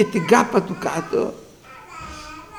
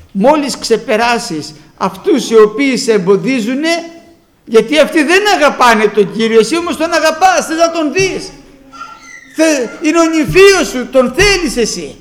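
raised, insistent preaching; vurige preek; 5 semitones higher, 6 dB louder than usual. Acted. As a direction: press it on the listeners, pitch climbing. Preaching at full force.

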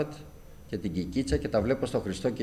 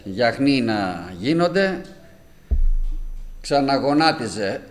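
slow preaching; 3 semitones higher, 5 dB louder, slower than usual. Acted. Preacher tells of his mother.

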